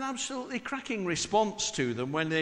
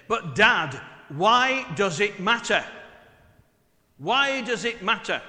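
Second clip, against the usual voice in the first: loud, exasperated plea, "why" pushed higher; exasperated daughter; 4 semitones higher, 9 dB louder than usual. Retold or imitated. Daughter arguing with her father, loud and exasperated. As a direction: throw it back at dad, fed up and loud. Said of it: "turn round exasperated and say".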